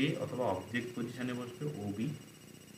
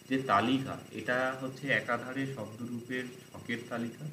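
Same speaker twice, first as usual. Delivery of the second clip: ordinary talk, nothing marked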